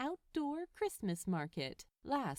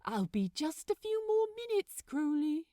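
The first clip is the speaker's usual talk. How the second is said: much higher, louder gentleman's call; Aziraphale calling; 8 semitones higher, 5 dB louder than usual. A narrator's reading. Angel calling from the kitchen to Crowley, higher and louder. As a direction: sing it out from the kitchen. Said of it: "Aziraphale called from the kitchen".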